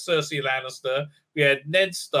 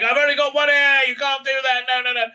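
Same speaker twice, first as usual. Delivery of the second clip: much higher, louder protesting cry; upset protest; 9 semitones higher, 3 dB louder, faster than usual. Retold or imitated. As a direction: hit it like a wounded outburst. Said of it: "she's like".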